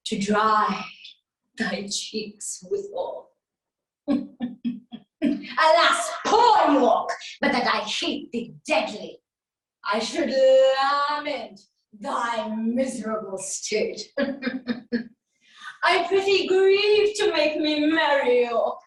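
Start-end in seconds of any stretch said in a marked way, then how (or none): 4.1-5.0 staged mocking chuckle; gloating laugh. Gloating over the captive. 14.1-15.0 staged mocking chuckle; gloating laugh. Gloating over the captive.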